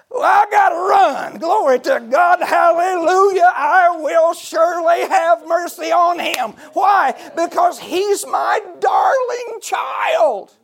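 high, loud, tearful shout, slowing on the last words; ecstatic preaching. Real, not acted. Preacher erupting over God's mercy, ecstatic tearful shouting. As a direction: let the joy burst out in full voice.

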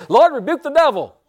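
high, loud mock-revival cry; hammed-up old Pentecostal prayer shout; old-time Pentecostal. Preacher imitating old Pentecostals for the church, high loud cry. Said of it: "like the old Pentecost used to say".

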